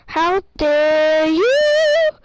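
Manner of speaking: slow, loud, stretched-out wail; a kid's hammed-up crying shout; crying outburst. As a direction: drag out each word through pretend tears. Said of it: "Chris cries and says".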